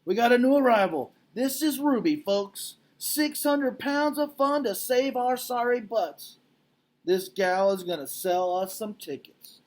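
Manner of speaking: high, loud, slow carnival hype; showman barker. Acted. Mac announcing the baby elephant to the crowd, brash barker. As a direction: sell her like a huckster to the crowd. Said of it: "Mac yells".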